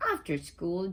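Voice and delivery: plain reading voice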